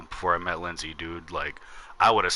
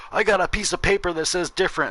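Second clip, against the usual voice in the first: high-pitched mock protest; arguing old man; 8 semitones higher than usual. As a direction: imitate a stubborn neighbor contesting cops. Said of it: "still trying to argue with the cops".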